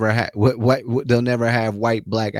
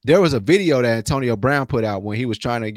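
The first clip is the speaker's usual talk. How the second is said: usual speaking voice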